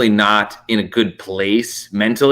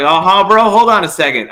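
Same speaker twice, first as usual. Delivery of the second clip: higher, louder cocky "bro" reply; 6 semitones higher, 4 dB louder than usual. Retold or imitated. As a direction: act ready to fight, casual and cocky. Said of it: "I was like".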